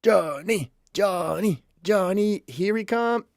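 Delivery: high sing-song summons; lilting call to a missing guest; playful calling. Pretend.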